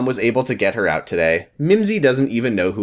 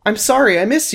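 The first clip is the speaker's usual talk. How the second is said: Amy voice; 11 semitones higher than usual. Acted. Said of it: "Amy apologizing to sister".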